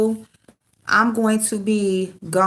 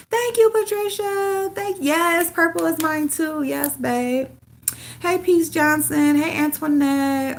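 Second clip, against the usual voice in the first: higher sing-song hellos to viewers; bright greetings; 8 semitones higher than usual. Real, not acted. Streamer greeting chat viewers by name, higher sing-song. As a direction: greet each fan like a friend walking in.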